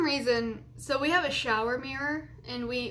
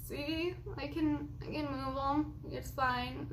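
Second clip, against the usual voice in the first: soft insisting; 3 semitones higher, 6 dB quieter, slower than usual. Retold or imitated. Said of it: "I would be like".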